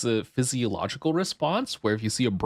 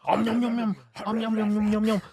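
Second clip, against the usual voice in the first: high squeaky nom nom chant; cutesy om nom; 10 semitones higher than usual. Pretend. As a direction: nibble it like a tiny creature. High cutesy eating noises.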